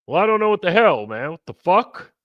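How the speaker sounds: mock him, confused and indignant; Andrew B impression. Host imitating a guest's reaction, high and indignant. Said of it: "Andrew B was like".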